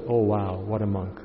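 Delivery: hushed imitation of a dazzled observer; awed onlooker. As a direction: gush quietly like an impressed onlooker. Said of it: "And then to you, the observer".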